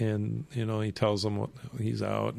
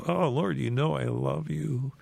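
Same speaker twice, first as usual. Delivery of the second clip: pleading protest; 5 semitones higher than usual. Retold or imitated. Peter answers Jesus asking again, pleading protest. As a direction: retell it as an earnest protest.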